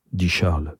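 plain reading voice

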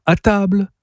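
high, sweet dinner call; gentle singsong summons; gently calling out. A narrator's reading. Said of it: "crie-t-elle doucement".

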